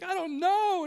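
high, loud mock wail; John Piper. Weeping preacher answering his wife, mock wail. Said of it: "he was just weeping".

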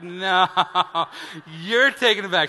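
loud, laughing "no" to his wife; laughing as he tosses the job back to his wife; laughing refusal. Retold or imitated.

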